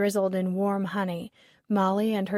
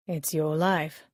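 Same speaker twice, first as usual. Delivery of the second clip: teasing; 3 semitones lower, slower than usual. A narrator's reading. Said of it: "Garrett teased".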